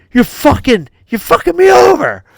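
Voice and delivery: imitated furious yell. Friend cheated by the referee player, building imitated yell. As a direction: start indignant and climb to a yell.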